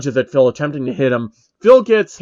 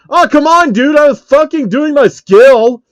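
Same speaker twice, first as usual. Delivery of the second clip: very high, loud outraged whine; furious gamer outburst; frustrated yell; 12 semitones higher, 10 dB louder than usual. Acted. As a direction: rage at the unfair hit. Phil knocked out of attack.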